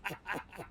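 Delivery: soft, brief chuckle; short chuckle. Real, not acted. Reading the chat, short soft chuckle. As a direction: let out a small laugh.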